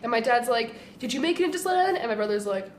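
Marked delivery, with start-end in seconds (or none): 1.0-2.0 higher, eager fatherly question; a dad keen to hear. Retold or imitated. Dad asking the brother, lightly voiced eager question.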